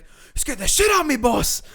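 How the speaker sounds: high, loud mock yelling; shaken worker protesting. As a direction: imitate a worker who just got a fright. Acts out frightened worker protesting.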